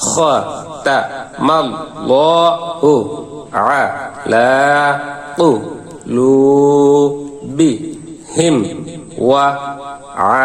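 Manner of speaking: each syllable said alone with pauses; broken syllables. Shown as a demonstration. Teacher breaks the words into separate syllables. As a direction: say it syllable by syllable, slowly. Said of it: "ভাঙা ভাঙা উচ্চারণ করব".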